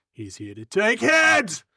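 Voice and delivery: high, slow climactic yell; hammed-up warlike bellow; battle shout. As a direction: stretch and shout the final words.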